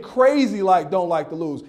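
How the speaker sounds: normal talking voice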